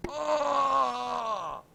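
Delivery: sudden high shouted "Oh!"; a jolted yelp of surprise; startled cry. Acted. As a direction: blurt it out, shocked and loud. Sudden outburst while reading script.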